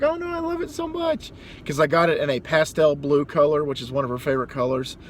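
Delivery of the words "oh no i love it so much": imitating wife. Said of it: "and she's like".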